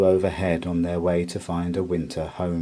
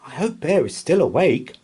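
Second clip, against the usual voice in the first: puffing; 8 semitones higher, 5 dB louder than usual. A narrator's reading. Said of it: "she puffed".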